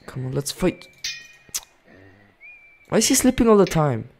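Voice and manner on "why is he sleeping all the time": high, loud complaint; loud exasperation. Real, not acted. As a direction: cry it out in exasperated disbelief. Exasperated at opponent in game.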